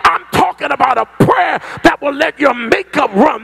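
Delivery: shouting, high-pitched preacher crying out for fire; fiery preaching. Acted. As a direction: preach it full force, calling down fire. Preacher building to climax.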